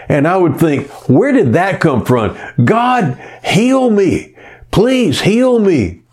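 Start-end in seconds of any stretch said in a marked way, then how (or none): 1.1-6.0 high, drawn-out plea to God; retold bedside prayer, voice up high; desperate pleading. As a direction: beg for healing. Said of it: "the more I would pray like that".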